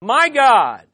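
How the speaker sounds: loud, slow proclamation, phrase by phrase; big drawn-out OH, then measured, forceful phrases; leading a recitation. Shown as a demonstration. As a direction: lead the congregation in a booming chant. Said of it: "let's say all three together".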